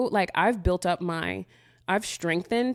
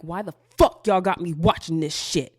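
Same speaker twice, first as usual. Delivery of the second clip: mock outburst; 5 dB louder than usual. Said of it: "then I'm like".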